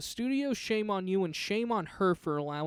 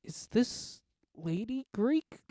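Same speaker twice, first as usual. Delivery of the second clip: pointed question; 6 semitones higher, slower than usual. Retold or imitated. Retold whisper to friend in cinema.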